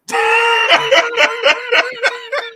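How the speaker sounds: loud, high-pitched cackle; big laugh. Real, not acted. Host cracking up at the co-host, loud high laughing fit. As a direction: burst out in a long cackle.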